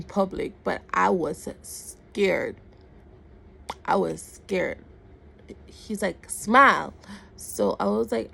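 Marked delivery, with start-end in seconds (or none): 6.3-6.9 loud one-word command; imitation of a shouted demand; retold barked order. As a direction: bark it like an impatient order. Retold man ordering smile.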